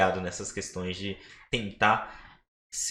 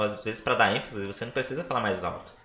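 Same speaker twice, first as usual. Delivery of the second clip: normal talking voice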